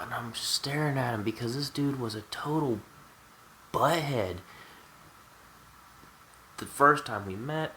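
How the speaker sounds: usual speaking voice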